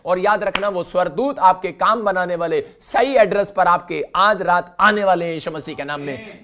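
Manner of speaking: loud fervent revival preaching; preacher rousing a praying congregation, loud and fervent; preaching at full force. Acted. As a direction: drive the crowd to shout amen. Congregation shouting amen.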